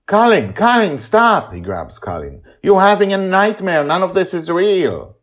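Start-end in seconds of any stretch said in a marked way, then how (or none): 0.1-1.5 high, much louder shout to stop; a father yelling at the dreaming boy to stop. A narrator's reading. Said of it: "Justin yells". 2.6-5.2 high, much louder urgent call; a father shouting to the dreaming boy that it isn't real. Acted. Justin shouting over the battle.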